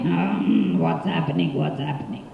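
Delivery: very low, soft growly question; tiger's voice. Acted. Puzzled tiger asks in the dark, very low. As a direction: rumble it from deep down. Confused big animals voiced.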